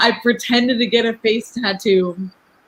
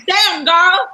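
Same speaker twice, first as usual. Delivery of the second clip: raised-pitch street catcall; mock catcaller; 7 semitones higher, 4 dB louder than usual. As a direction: call out like a leering passerby.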